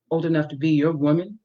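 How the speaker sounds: ordinary delivery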